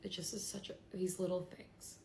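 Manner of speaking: grieving, struggling to get the words out; real sadness. Real, not acted. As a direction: voice thick with real grief. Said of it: "I just am so sad".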